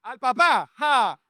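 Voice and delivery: high-pitched, emphatic preaching; rousing punchline. Real, not acted. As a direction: drive the joke home to the crowd. Punchline thrown at congregation.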